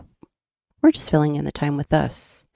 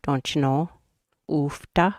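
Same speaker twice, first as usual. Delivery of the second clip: put-on Minnesota accent; 3 semitones lower, 7 dB quieter than usual. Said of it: "our accent is like the movie Fargo".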